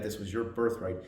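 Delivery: ordinary talk, nothing marked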